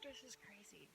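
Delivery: whispered wonder at the sky; awed whisper. Real, not acted. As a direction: whisper it, barely believing. Awed hush under the rainbow.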